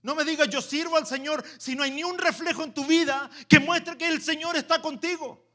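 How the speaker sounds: loud, high preaching burst; preaching at full force. Acted. Preacher scolding the church, full force. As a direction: preach it hard at the congregation.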